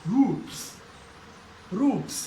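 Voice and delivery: रूफ्स with a clear फ्स ending; pronunciation demo. Shown as a demonstration. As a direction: model रूफ्स, sounding the final स after फ. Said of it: "और स".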